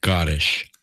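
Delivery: put-on Scottish accent with a long shushed S in "Scottish"; Sean Connery. Goading a co-host to do the accent, mock Scottish slur. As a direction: hiss the start of "Scottish" like "shhh-cottish", mock Scottish accent. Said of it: "You can do the Sean Connery thing".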